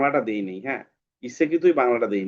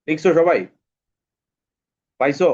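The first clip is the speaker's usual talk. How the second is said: louder check on the class; calling out to class; 3 semitones higher, 6 dB louder than usual. Real, not acted. Teacher calls out to the class to check they are writing, louder. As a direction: raise the voice to reach every student. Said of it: "teacher checks the class".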